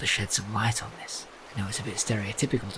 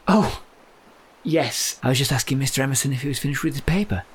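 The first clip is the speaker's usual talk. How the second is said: flustered cover story; 4 semitones higher, 4 dB louder than usual. Acted. Caught out, covering quickly.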